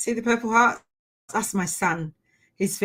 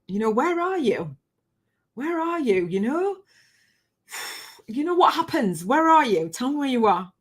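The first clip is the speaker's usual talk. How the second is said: higher-pitched retold pleading; lightly acted, sing-out questioning; searching plea; 6 semitones higher than usual. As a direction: call out searchingly to someone missing. Retold questioning of her late son.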